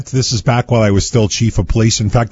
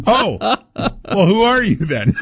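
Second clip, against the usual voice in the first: higher, a retold question half laughed through; laughing; 10 semitones higher, slower than usual. Chief retells the confused fan's reply, laughing. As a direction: quote the puzzled fan while laughing.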